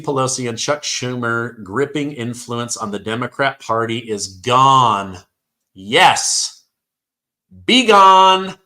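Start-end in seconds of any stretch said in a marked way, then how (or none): 5.7-8.6 loud gloating cheer; triumphant shout. Real, not acted. Cheering Pelosi's influence gone.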